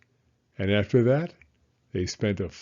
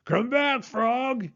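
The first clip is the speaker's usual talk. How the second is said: much higher, louder plea; shouted; 14 semitones higher, 4 dB louder, slower than usual. Acted. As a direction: shout after him to come back. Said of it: "he shouted".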